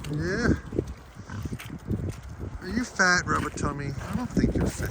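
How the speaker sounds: high, soft cooing. Real, not acted. Owner teasing her big sow in baby talk. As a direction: tease the pig gently in a high coo.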